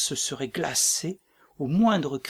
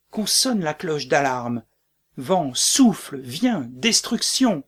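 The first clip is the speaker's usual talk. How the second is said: defiant cry; 5 dB louder than usual. Acted. Macbeth shouts at the wind, calling destruction down. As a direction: hurl a challenge at the storm.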